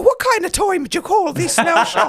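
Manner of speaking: high, loud scolding Irish mother at the door; mock Irish mammy. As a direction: nag like an Irish mammy waiting up.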